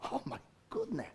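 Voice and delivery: ordinary talk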